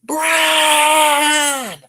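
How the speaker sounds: long high call. Acted.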